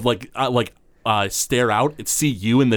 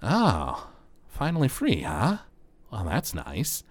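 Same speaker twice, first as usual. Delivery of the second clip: sly halfling; 8 dB quieter, slower than usual. Acted. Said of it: "he kinda, like, goes".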